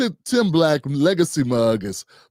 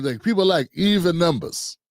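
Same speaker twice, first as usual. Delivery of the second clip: ordinary talk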